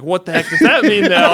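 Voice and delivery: retold through laughter; mock baffled, laughing; laughing quote. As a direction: ask it while laughing. Retelling the nurse's badass remark.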